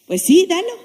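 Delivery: mom's reply. Retold or imitated. Mother finally accepts.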